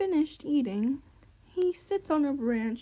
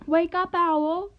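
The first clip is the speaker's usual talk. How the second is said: higher, louder, slow wake-up call; 4 semitones higher, 5 dB louder than usual. Acted. Magpie calling out to wake the sleeping owl. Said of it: "calls the magpie".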